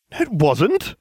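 high-pitched indignant protest; crying out. A narrator's reading. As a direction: blurt out a protest. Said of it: "Hermione cried".

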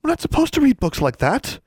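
high, anxious objection; tremulous. A narrator's reading. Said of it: "she asked tremulously".

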